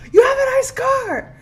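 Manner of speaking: high, loud yell of a thrilled passerby; imitated excited yell. Young men yelling at her in her car, excited yell. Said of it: "They're yelling at me".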